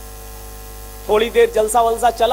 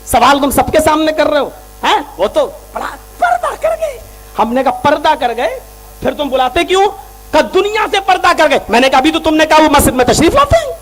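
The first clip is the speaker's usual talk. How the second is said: loud, higher, building heated delivery; fiery preaching; 6 semitones higher, 6 dB louder than usual. Acted. Preacher retelling the argument to the crowd, building shouts. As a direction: drive it to a shouting climax.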